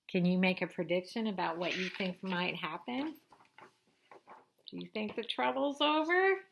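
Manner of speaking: ordinary delivery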